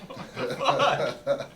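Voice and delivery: slow, high, laughing "what"; laughing disbelief. Retold or imitated. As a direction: stretch it out in laughing shock. Retold own reaction, laughing.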